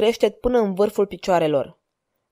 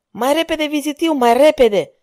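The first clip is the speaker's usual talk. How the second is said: panicked shout to go faster; 6 semitones higher than usual. A narrator's reading. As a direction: yell it in terror, urging speed. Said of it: "strigă el, cuprins de panică".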